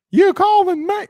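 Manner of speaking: put-on a high, loud call of a kid answering; imitated kid's call. Kid at the door answers dad, loud high call. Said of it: "their little twang to him".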